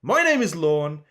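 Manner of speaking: ordinary talk, nothing marked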